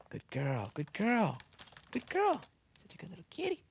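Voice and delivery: high cooing pet praise. Real, not acted. Praising a cat.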